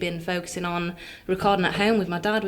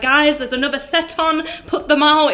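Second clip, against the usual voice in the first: imitated call to a crowd, higher and slower; lightly voiced venue host; 5 semitones higher than usual. Little Scotsman calling smokers back for next set, imitated call. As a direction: lightly act out herding smokers back inside. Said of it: "the little Scotsman, will just be there like".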